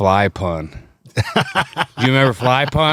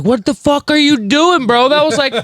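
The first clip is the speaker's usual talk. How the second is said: shocked shout; 6 dB louder than usual. Retold or imitated. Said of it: "He's like".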